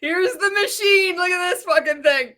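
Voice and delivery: mock showman. Mocking the presenter's device, a lightly hammed-up showman. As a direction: present the junk like a prize.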